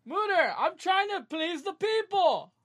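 high, loud, mocking mother's shout; mock mom yelling at her son; shrill mock mom. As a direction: screech it like a nagging mom. Mocking mom's voice.

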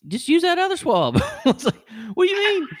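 high and loud disbelief; incredulous protest. Retold or imitated. Patient protesting the doctor's second swab, high and loud incredulous. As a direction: squeak it out in outraged surprise.

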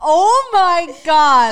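loud, slow delighted oh my God; excited. Real, not acted. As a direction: stretch it out in delight. Reacting with delight.

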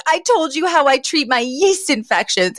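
high-pitched mortified outburst; losing it. Retold or imitated. Fran realizing what she revealed to a straight man, losing it. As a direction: imitate someone appalled at oversharing. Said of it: "losing it when she realizes all that she revealed".

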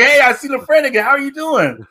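high, grinning drugged-out greeting; a stoned friend beaming hello; Peaches greeting. Retold or imitated. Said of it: "he's like".

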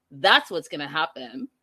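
ordinary talk

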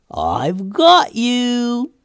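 high, slow, gleeful sprite taunt. Acted. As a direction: drag out the taunt like a prankster sprite. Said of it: "said the sprite".

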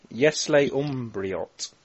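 very slow, careful Russian; slow careful repeat. Shown as a demonstration. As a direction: repeat it slowly, word by word. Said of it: "Say that again".

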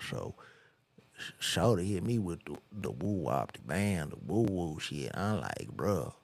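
mumbled, slurred rapper parody; mock rapper talk. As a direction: imitate an inarticulate rapper telling a story. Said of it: "this is how they talk".

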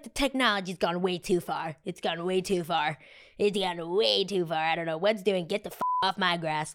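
put-on cranky old-timer complaining about technology; grumpy old person. Vlogger mocking herself as a grumpy old person. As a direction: shake your fist like an old man yelling at kids. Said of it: "that's what I feel like I sound like".

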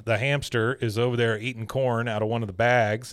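normal talking voice

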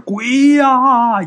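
high, loud retold yell of terror; a hammed-up shriek of fright; mock scream. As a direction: cry it out like you just saw a ghost. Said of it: "你哥我当时就大叫一声".

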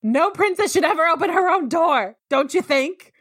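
high, frantic blurt of a rehearsed line; awkward guy yelling his line too eagerly; mock frantic shout. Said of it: "essentially screams".

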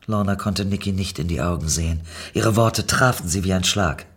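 ordinary delivery, nothing marked

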